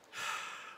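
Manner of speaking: audible breathy exhale; demonstrated sigh of release; little sigh. Shown as a demonstration. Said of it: "A little sigh".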